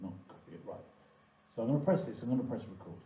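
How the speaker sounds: normal talking voice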